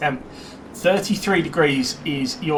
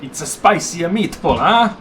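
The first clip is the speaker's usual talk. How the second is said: hammed-up mock Italian accent, tag lifted at the end; terrible Italian accent. Commentator joking to co-commentator about a spicy finish, terrible Italian accent. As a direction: put on a bad, jokey Italian accent. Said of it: "that's my terrible Italian accent".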